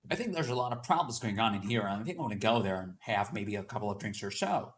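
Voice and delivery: hurried American English; American accent. Shown as a demonstration. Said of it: "Maybe a kind of an American accent".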